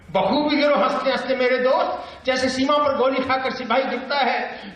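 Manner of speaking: high, declaimed verse; Bhavani Prasad Mishra. Acted. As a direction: send the lines out high to the crowd. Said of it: "बातचीत की शैली".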